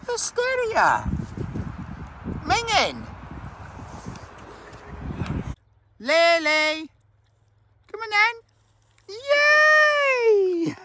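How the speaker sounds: high sing-song talk to a dog. Real, not acted. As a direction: coo at the dog. Talking to the dog.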